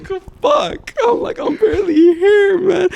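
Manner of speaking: high, loud whining through laughter; whiny laughing apology. Retold or imitated. Player apologizes to coach, whining high and laughing. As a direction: beg forgiveness like a kid, half laughing.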